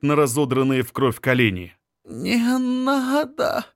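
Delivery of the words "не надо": put-on high, slow, hoarse begging girl; hoarse pleading. A narrator's reading. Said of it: "умоляет она хрипло".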